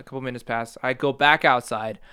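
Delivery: normal talking voice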